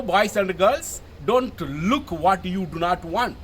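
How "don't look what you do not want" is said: normal talking voice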